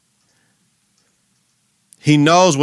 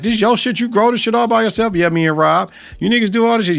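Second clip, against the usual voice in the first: high, amazed questioning; surprised questions; 9 semitones higher than usual. Retold or imitated. Retelling Alicia grilling them about the weed, high and amazed. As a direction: raise the pitch, amazed at what she sees.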